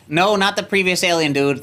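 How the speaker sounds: ordinary talk, nothing marked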